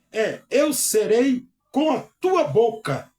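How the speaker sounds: lendo a escritura devagar e com ênfase; leitura bíblica solene. Acted. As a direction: proclame a palavra de Deus, pausado. Reads God's words solemnly. (pregador lendo a Bíblia para a igreja, em tom solene)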